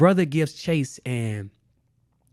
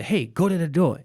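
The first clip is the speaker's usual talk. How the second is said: mild mock yell, raised pitch; retold yell; 5 semitones higher, 3 dB louder than usual. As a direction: call out to a sibling, lightly imitated. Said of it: "we yell to my sister".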